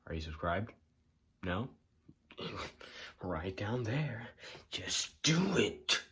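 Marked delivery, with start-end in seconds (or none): 4.7-6.0 Just do it drawn out slow and high; stretched coaxing. Acted. Urging the viewer to click, slow and high.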